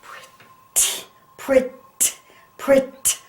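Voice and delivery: "pret" broken off on a sharp, separate t; sounded-out T. Shown as a demonstration. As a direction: pop the final t of "pret" after a short gap.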